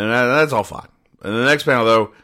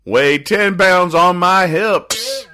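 mock crying; 8 semitones higher, 4 dB louder, slower than usual. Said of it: "And she's crying".

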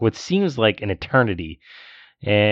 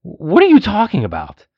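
exasperated; 6 semitones higher, 6 dB louder than usual. Real, not acted. Exasperated at rude moviegoer.

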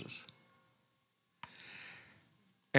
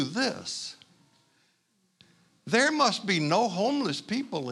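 ironic stranger; 7 semitones higher than usual. Retold or imitated. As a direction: praise the gold while meaning the poor. Stranger voiced, ironic praise.

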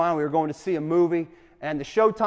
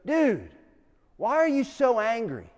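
higher-pitched Californian dude voice; slight Californian accent; 5 semitones higher than usual. Retold or imitated. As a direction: imitate a laid-back Californian dude. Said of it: "in his slightly Californian accent".

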